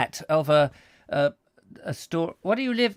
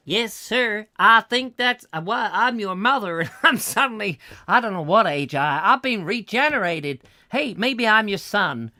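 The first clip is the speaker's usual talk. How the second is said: high, loud put-on American; hammed-up improvised American family voice; American mother character; 6 semitones higher, 5 dB louder than usual. Mother suddenly becoming the son, hammy American accent.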